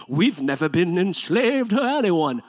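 snooty put-on British accent; pretentious British accent. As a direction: play a pompous British Pharisee, dragging it out. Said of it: "give them a British accent, just makes them sound pretentious".

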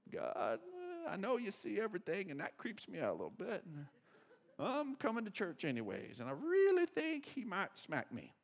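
soft, low, sheepish little prayer; hushed, half-laughing confession to God; nervous prayer. Pretend. Worried churchgoer praying quietly, sheepish and soft. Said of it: "churchgoer's nervous prayer".

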